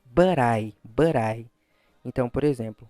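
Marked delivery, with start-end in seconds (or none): 0.0-1.5 "but I" joined as "buh-rai", with a tapped t; linked pronunciation. Shown as a demonstration. Teacher models English linking for students, "but I" as "buh-rai". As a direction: run "but I" together, t becoming a quick r. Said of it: "a pronúncia fica assim".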